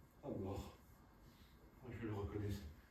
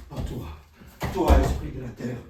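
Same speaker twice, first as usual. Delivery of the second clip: fervent invocation; 11 dB louder than usual. Acted. Faust calling on spirit.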